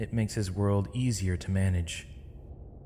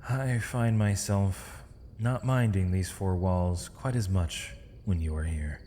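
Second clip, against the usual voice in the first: normal talking voice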